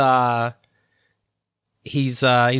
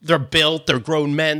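normal talking voice

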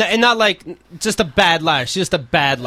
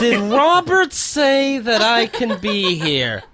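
mock slow playing dumb; 7 semitones higher, much slower than usual.

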